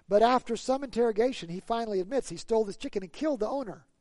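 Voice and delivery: ordinary delivery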